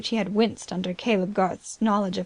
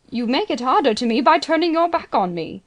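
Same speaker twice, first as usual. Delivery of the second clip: higher, louder, begging voice; pleading cry; 4 semitones higher, 6 dB louder than usual. A narrator's reading. Banker begging the agent not to turn away, pleading loudly. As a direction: plead as if your standing depends on it. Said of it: "a genuine pleading cry".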